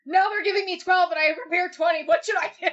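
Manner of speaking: high, loud mock panic, half shouting; panicked yell. Texting a friend about a shortened set, mock panicked shout. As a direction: blurt it out like a frantic plea for help.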